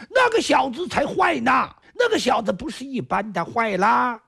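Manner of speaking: high, shouted complaint in a lilt; indignant shout. Acted. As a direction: shout your outrage in the fake accent. Disguised man badmouths himself.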